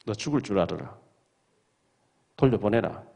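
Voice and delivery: a quiet threat, voiced lightly; mock threat. God threatening Abimelech in a dream, low quiet menace. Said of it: "협박하시는 거죠".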